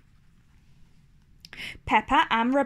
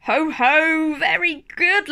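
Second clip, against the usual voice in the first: hearty laugh; 4 semitones higher, 4 dB louder than usual. Acted. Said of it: "laughs Daddy Pig".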